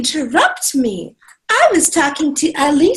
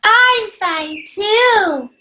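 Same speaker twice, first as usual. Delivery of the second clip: puppet voice; 4 semitones higher, much slower than usual. Acted. Monkey puppet answers.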